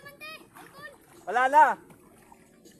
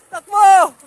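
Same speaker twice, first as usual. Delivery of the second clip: loud, higher call to run; shouting; 5 semitones higher, 17 dB louder than usual. Real, not acted. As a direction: yell at them to run. Urging kids to run.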